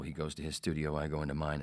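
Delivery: usual speaking voice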